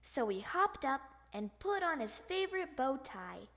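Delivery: usual speaking voice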